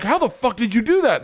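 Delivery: high, incredulous demand; stunned dad. Retold or imitated. Grandfather demanding how, high with disbelief. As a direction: pitch it up in disbelief. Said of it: "he's like".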